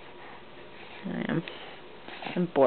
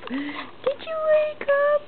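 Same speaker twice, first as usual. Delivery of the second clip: high slow coo; slower than usual. Real, not acted. Mother asking her baby again, very high slow coo.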